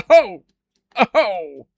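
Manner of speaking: loud, pained pun groan. Real, not acted. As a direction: groan loudly at the bad pun.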